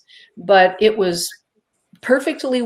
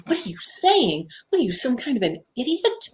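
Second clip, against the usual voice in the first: self-scolding; 5 semitones higher than usual. Retold or imitated. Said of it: "my reason was saying".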